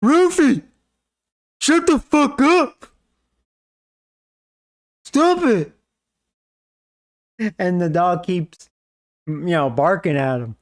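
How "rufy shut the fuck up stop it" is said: high shrill-pitched furious yell at the dog; angry kid yelling. Retold or imitated. Gamer kid screaming at his barking dog, furious high yell. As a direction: scream it like a bratty kid losing it. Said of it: "he goes".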